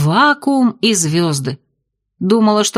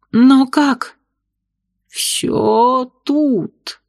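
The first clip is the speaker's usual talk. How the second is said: gurgling tentacle alien; 4 semitones higher, much slower than usual. Acted. Octopus bartender speaks.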